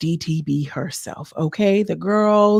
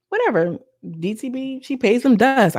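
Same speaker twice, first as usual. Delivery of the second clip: normal talking voice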